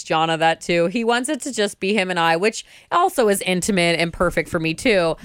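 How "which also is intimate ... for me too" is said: usual speaking voice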